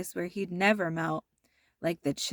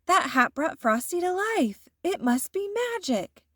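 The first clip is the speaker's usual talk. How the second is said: put-on higher, wonder-struck little girl voice; amazed girl; 9 semitones higher than usual. A narrator's reading. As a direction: marvel at the magic. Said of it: "Karen gasped and said".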